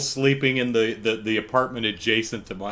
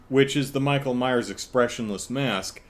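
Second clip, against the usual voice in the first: usual speaking voice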